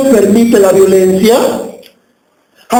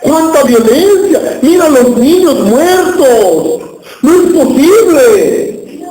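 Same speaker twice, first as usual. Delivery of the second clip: acting out a shocked onlooker, higher and drawn out; horrified dismay; 5 semitones higher, slower than usual. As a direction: cry out slowly in mock horror at the news. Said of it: "usted se espanta y dice". (espanto fingido, lamento lento y agudo)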